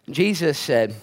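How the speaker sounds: ordinary delivery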